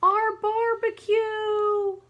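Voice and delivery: high, loud, slow wailing yell; loud stretched-out cry of dismay. Acted. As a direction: wail over the lost feast. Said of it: "little Creepshaw right here yells".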